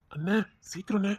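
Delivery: hurried whisper to her brother; hushed and urgent. Acted. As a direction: whisper it, pressing him to hurry. Urging brother in secret.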